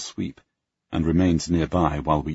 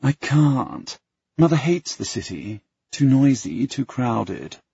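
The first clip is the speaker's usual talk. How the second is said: pouting; 7 semitones higher, 3 dB louder, slower than usual. Acted. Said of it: "She pouts".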